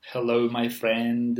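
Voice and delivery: slow Mediterranean-accented English; drawn-out demo of a Mediterranean accent. Shown as a demonstration. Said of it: "like, a Mediterranean accent".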